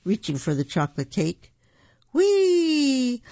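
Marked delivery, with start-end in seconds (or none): 2.1-3.2 high, long gleeful whee; gleeful whee sliding off. A narrator's reading. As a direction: slide down with delight. Bouncing off daddy's shoulders.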